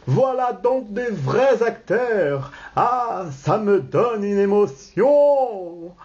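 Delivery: high, swelling emotion; thrilled. Acted. Balandard finally sees real actors, thrilled. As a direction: draw it out, overcome at the sight.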